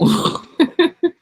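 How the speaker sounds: bursting out laughing high and loud; a high, loud laugh breaking the reading. Real, not acted. Reader cracking up at her own joke, laughing loudly.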